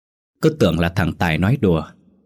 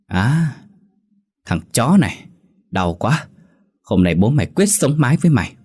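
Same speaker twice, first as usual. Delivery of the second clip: higher-pitched indignant threat; drunken anger; 5 semitones higher than usual. A narrator's reading. Drunk man threatening his attacker, indignant and higher. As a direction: threaten back like an offended drunk.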